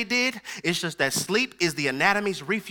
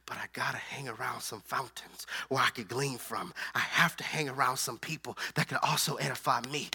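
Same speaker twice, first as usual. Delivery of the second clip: hushed preaching; 8 semitones lower, 10 dB quieter than usual. Real, not acted. Preacher drops voice very low.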